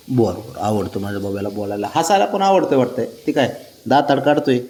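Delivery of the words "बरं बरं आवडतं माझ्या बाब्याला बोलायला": much lower, softer cooing to a pet. Real, not acted. To the pet bird Babya who likes to talk, soft low cooing. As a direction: coo it fondly to the bird.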